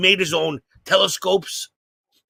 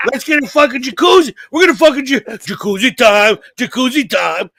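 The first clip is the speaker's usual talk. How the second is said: loud, higher, giddy chant; excited kids shouting; 5 semitones higher, 4 dB louder than usual. Retold or imitated. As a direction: chant it like kids hyped for a jacuzzi. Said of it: "Me and my brother are like".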